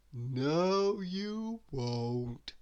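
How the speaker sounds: slow, drowsy lazy drawl; lazy Mister Lazy. A narrator's reading. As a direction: yawn it out like a lazybones. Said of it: "said Mister Lazy".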